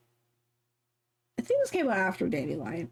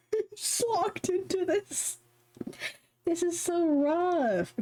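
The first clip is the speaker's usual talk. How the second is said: mock despair; 10 semitones higher, slower than usual. Real, not acted. Groaning over her playtime.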